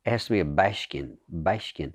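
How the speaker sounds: "Bashkin" with a flat A and an SH for the S. Shown as a demonstration. Actor teaches the Joe twang, saying "Bashkin" with SH. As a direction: hiss the S as SH in "Bashkin". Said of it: "the A has to be a".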